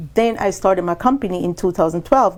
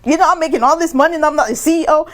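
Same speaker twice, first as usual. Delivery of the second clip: indignant; 8 semitones higher, 4 dB louder, faster than usual. Retold or imitated. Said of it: "get off my high horse".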